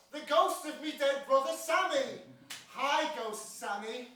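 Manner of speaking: ordinary delivery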